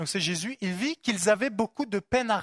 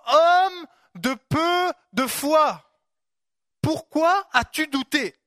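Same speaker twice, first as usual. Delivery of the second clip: higher, louder, slow reproach; solemn rebuke; 6 semitones higher, 5 dB louder, much slower than usual. Retold or imitated. Jesus rebuking doubting Peter, slow and loud. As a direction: draw out each word in rebuke.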